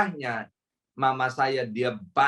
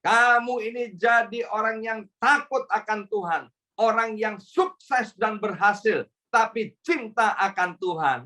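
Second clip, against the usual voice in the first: higher, fervent parental blessing; parent's blessing; 7 semitones higher than usual. Shown as a demonstration. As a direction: bless your child earnestly, voice lifted. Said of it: "Kutuknya gimana?".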